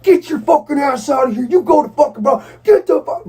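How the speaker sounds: retold sergeant major yelling at a soldier to get out; imitating a yell. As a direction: bark him out of the room like a furious sergeant major. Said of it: "he's fucking just yelling and screaming at me".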